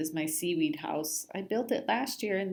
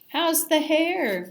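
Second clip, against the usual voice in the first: high slow teasing; 9 semitones higher, 5 dB louder, slower than usual. A narrator's reading. Miguel teasing Simon.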